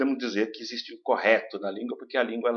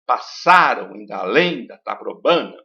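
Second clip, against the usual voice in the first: slow, louder, open vowels stressed; recited verse; 5 dB louder than usual. Shown as a demonstration. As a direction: recite the line leaning on the A. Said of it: "Aqui é o A".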